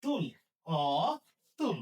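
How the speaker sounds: অতুল drawn out as ɔ... tul; syllable-by-syllable demo. Shown as a demonstration. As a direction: hold an open aw, then finish অতুল with tul. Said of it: "এখানে উচ্চারণ কি লিখতে হবে?". (অতুল খোলা অ টেনে উচ্চারণ)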